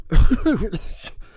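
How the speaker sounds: high loud cackling laugh; old people's cackle. Acted. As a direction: cackle like excited old folks. Geezers cheering then laughing.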